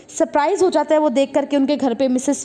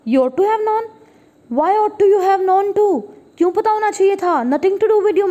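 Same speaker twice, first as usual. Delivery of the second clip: a hammed-up indignant outburst; angry raised voice; 6 semitones higher, slower than usual. A narrator's reading. George shouting at distressed neighbour.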